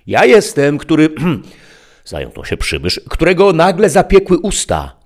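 high, loud stammering stranger; a sly visitor caught off guard; stranger's voice. A narrator's reading. Tempter's stammering entrance. (wysoki, głośny głos przybysza)

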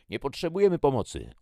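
loud, high-pitched defiant refusal; shouting back. A narrator's reading. Jackie refusing the stranger's help, shouting back. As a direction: yell back that help is unwanted. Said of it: "odkrzyknęła Jackie".

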